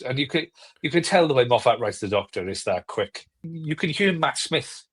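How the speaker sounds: normal talking voice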